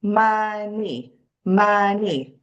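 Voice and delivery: money split into two clapped beats; money, two even syllables. Shown as a demonstration. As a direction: clap mon-ey as two beats. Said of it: "say the word money while we clap".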